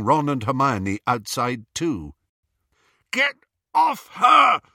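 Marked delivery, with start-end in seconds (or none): 3.1-4.6 high, loud, drawn-out shout; desperate shout. A narrator's reading. Ron to captors dragging Hermione out, desperate shout. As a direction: shout it at the top of your range. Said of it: "Ron shouted".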